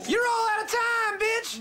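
high, slow shouted taunt; gloating shout. Acted. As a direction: yell it in triumph. Villain taunt showdown.

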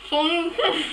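talking through marshmallows; mouth full. Real, not acted. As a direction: say it with cheeks stuffed full. Chubby bunny, mouth full of marshmallows.

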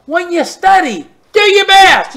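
"do your best" punched high and loud; loud high exhortation. Acted. Preacher exhorting students, loud and high. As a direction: ring it out as a command. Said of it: "preaching emphasis".

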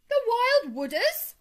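high, loud, drawn-out shock; loud, aghast question; alarmed cry. A narrator's reading. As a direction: cry out in horror at the name. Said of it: "cried Toad, trembling in every limb".

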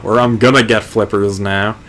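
ordinary delivery

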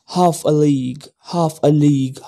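Half a league, half a league with HALF punched loud and a league light; Half a league, half a league recited with loud, rhythmic stress on HALF; poetic rhythm demo. Shown as a demonstration. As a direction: chant Half a league in a strong falling dactyl beat. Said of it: "half and a are stressed".

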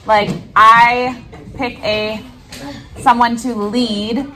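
ordinary delivery

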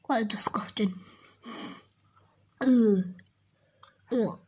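breathy disgusted whisper under the breath. Real, not acted. To viewers after a bad taste, a disgusted whisper. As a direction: recoil at the taste in a hushed voice.

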